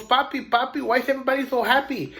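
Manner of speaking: put-on a boy bursting to know; excited little boy. Little boy asks his papa why the crowd cheers, fast and high. Child voiced in story.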